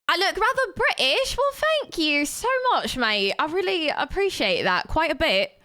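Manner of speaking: put-on high, playful British accent. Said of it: "accent change, damn".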